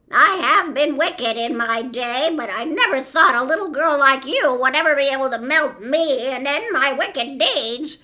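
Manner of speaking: loud, high witch voice, lamenting; loud, anguished witch bemoaning her end; melting wicked witch. A narrator's reading. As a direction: wail the last speech as a doomed witch.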